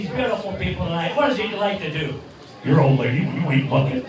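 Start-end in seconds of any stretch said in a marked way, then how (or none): 2.6-4.0 puppet bird voice. Acted.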